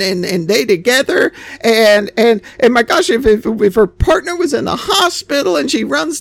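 high, mocking sentimental voice; mock emotional listener. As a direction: gush over the sad story, sending it up. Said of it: "be all emotional and be like".

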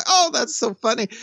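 light amused parent voice. Retold or imitated. Parent and kid laughing.

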